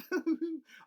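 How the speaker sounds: short high giggle; real amused laugh breaking out; high burst of laughter. Real, not acted. Laughing at dog sniffing.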